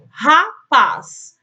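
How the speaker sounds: "Rapaz" in two loud beats, "Ra", "paz"; split into syllables. Shown as a demonstration. Teacher asking pupils to count, "Rapaz" syllable by syllable. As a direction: punch out "Ra" and "paz" separately. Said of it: "Quantas sílabas eu tenho?".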